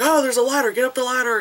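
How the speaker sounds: high, loud burst of mock urgency; urgent gamer. Player spotting a ladder mid-game, urgent. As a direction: spot a way out and urge everyone up.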